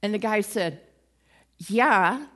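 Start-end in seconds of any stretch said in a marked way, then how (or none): 1.6-2.3 a loud, keen yes; eager loud yes. Acted. Sick man to Jesus, a keen loud yes. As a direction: blurt the yes out keenly.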